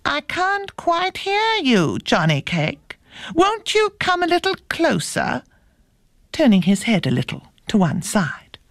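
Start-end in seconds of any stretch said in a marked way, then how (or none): 0.0-5.4 high, coaxing fox voice; storybook fox feigning poor hearing; sly fox. A narrator's reading. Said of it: "The fox said".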